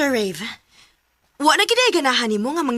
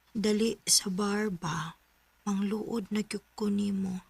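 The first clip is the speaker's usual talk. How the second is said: whisper; 10 dB quieter, much slower than usual. Pretend. Reading a flirty chat aloud.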